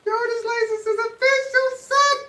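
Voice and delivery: high, loud excited yell; excited shout. Real, not acted. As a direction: shout it out thrilled. Excited over new license.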